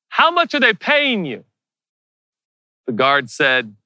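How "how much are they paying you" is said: high-pitched repeated question; calling up. Acted. Akiva repeating himself to the puzzled guard, high call. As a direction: ask it high, calling to someone overhead.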